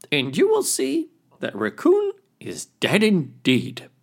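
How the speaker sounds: very high little yes; squeaky yes. Acted. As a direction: pipe it up high. Little crayfish replies to chief.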